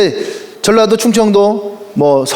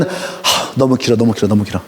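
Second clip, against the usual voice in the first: sigh, then lower and fading, softer; weary complaint; 11 semitones lower, 5 dB quieter than usual. Retold or imitated. Seoul member sighing over a long talk, low and fading. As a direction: groan that it is too long.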